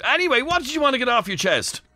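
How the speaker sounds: normal talking voice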